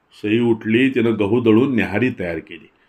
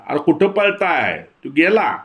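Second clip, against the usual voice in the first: higher, slower mock call; 7 semitones higher, slower than usual. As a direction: call after them to stop, pitched up. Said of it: "ओरडत म्हणाला".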